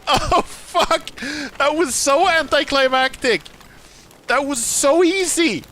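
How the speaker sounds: high, loud, laughing outcry; amused disbelief. Real, not acted. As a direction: exclaim it still giddy. Laughing at anticlimactic kill.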